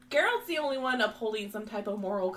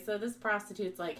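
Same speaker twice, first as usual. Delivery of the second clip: normal talking voice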